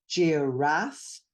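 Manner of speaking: "giraffe" slowly parsed, syllable by syllable; split into syllables. Shown as a demonstration. Teacher parsing a word for a struggling reader, "giraffe" in syllables. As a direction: sound out "giraffe" in separate syllables. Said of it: "parse it into syllables".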